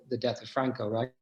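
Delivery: normal talking voice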